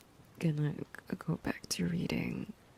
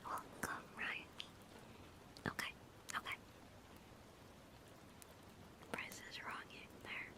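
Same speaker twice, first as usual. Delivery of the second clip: hushed, fully whispered stream chat; ASMR whisper; 15 dB quieter, faster than usual. Real, not acted. Streamer thanks viewers and explains the script's backstory, ASMR whisper. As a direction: whisper to the listeners throughout.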